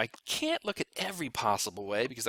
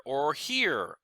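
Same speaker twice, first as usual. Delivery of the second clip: normal talking voice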